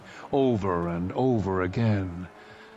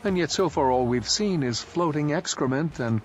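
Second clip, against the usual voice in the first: a touch higher, a disdainful woman; lightly voiced Imala; 6 semitones higher than usual. A narrator's reading. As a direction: lift the voice a little for Imala, unimpressed.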